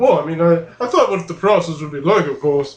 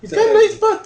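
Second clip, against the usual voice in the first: high character voice; 13 semitones higher than usual. Acted.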